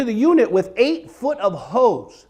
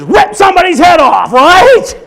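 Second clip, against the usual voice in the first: louder shouted rant; mock rage; 8 semitones higher, 9 dB louder than usual. Comedian confesses rage to audience, loud mock fury. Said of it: "describes road rage".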